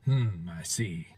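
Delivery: low, soft, slow mock composure; hushed, understated acknowledgement; muted polite reaction. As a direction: murmur it as if barely moved. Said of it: "not just sitting around going".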